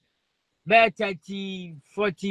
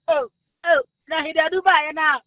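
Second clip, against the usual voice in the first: mock crying; 6 semitones higher, 4 dB louder than usual. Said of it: "you read that day".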